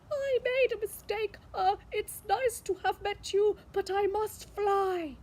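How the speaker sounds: high, fast, nervous dragon; nervous babble. Acted. Dragon backing away from the beast, high and fast. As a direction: gabble it out in a hurry.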